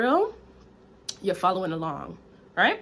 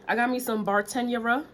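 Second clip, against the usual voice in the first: ordinary delivery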